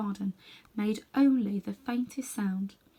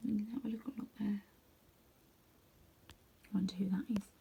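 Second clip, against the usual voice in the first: whisper; 10 dB quieter than usual. Real, not acted. Aside whispered off the text.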